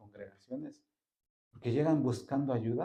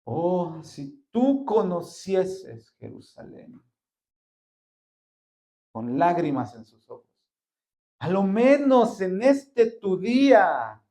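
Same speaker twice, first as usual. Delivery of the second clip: slow, high, loud anguished lament; Jesus crying out over Jerusalem; 6 semitones higher, 8 dB louder, slower than usual. Acted. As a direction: weep over the city.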